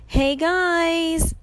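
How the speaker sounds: high, drawn call from above; distant yell. A narrator's reading. Grover hails his friends from the air, yelling. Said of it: "Grover yelled somewhere above us".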